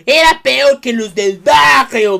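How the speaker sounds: put-on slow, loud grumpy old man shout; mock old-timer rant. Vlogger mocking old folks with a loud grumpy shout. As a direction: ham up a cranky old-timer yelling.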